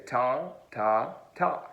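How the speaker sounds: "Ta, ta, ta" as separate syllables, tongue tapping behind teeth; tonguing demo. Shown as a demonstration. Teacher showing where the tongue goes, "Ta, ta, ta" as separate tongued syllables. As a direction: tap each "Ta" where teeth meet the roof of the mouth. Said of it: "I want you to say".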